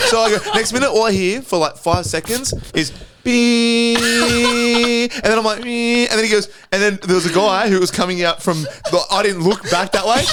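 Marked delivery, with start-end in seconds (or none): 3.2-5.1 long, high-pitched held beep; imitated car horn. Driver mimics the horn he heard, long high beep. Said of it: "this guy was beeping". 5.6-6.1 short high beep; imitated car horn. Storyteller imitating horn beeps, high-pitched beep. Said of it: "this guy was beeping".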